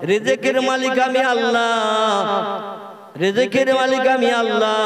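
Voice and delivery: loud preaching at full force; preacher rousing the listeners at full volume; fiery sermon. Acted. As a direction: boom it out to a big open-air crowd.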